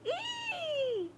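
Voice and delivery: high, thin squeal of surprise; delighted shriek. Acted. Child hit by the hose water, gleeful squeal. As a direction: let out a gleeful little shriek.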